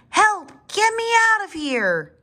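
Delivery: yelling for help. A narrator's reading. Littlest dinosaur stuck in a footprint, yelling. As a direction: call out high like someone tiny and stuck. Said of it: "having to yell".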